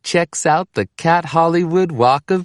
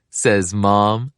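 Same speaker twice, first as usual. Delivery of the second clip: usual speaking voice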